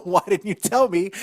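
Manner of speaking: playful outraged imitation; mock indignant. Imitating upset partner's future complaint.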